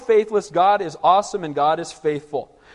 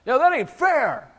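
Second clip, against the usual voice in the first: drawn-out, higher-pitched imitation of indignation; mock indignant protest; 6 semitones higher, slower than usual. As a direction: act out the flesh protesting, stretched and high. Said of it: "that's how your flesh responds".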